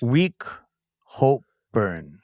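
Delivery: halting single words, dropping low at the end; sounding out. Real, not acted. Player reads the last card's odd words, halting. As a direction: read word by word, not yet understanding.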